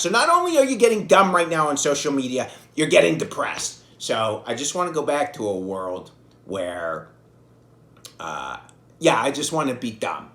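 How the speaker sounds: ordinary talk, nothing marked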